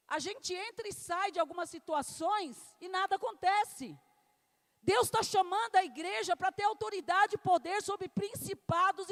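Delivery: loud, rising pulpit preaching; fiery preaching. Acted. Pastor preaching loud to the church about power. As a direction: preach it out to the congregation with full force.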